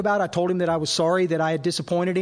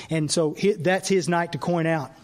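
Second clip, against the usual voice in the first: usual speaking voice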